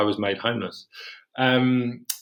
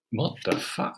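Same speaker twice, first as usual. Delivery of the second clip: shocked whisper; 6 dB quieter, slower than usual. Retold or imitated. Retold shock at rent hike.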